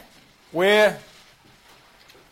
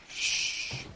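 soft shush; 8 dB quieter than usual. Real, not acted. Teacher hushing a chatty class, soft shush.

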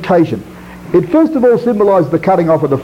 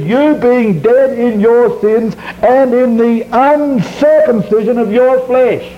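high, slow, stressed "You"; slow down and lift "You" to single them out; quoting Colossians to the brethren, slow and high; slow scripture emphasis; 6 semitones higher, slower than usual. Shown as a demonstration. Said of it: "I will emphasise certain words".

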